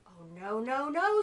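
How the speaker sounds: fly's refusal. Acted. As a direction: refuse the spider firmly. Fly refuses.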